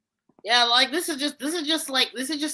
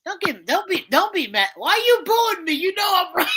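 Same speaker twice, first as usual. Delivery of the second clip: mock Irish accent; 5 semitones higher, 4 dB louder than usual. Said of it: "you've gone from Indian to Scottish to Irish".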